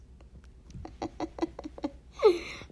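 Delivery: breathy giggle rising in pitch; real, drawn-out giggle; amused laugh. Real, not acted. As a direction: laugh helplessly at your own slip. Laughing at own word mix-up.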